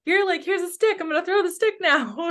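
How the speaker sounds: excited high-pitched talk to a dog; excited dog play. Retold or imitated. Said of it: "you know".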